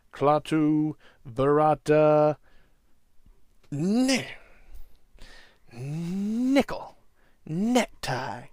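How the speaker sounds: slow, unsure, groping for the words; fumbling magic words. Retold or imitated. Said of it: "he's like".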